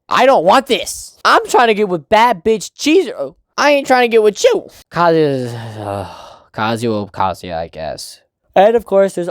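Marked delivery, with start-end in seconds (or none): 0.1-4.7 raised, emphatic mock telling-off; blunt rejection. What he should tell his girlfriend, bluntly rejecting.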